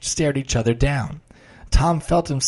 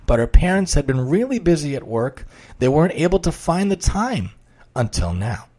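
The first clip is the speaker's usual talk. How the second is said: story narration; 4 semitones higher than usual. A narrator's reading.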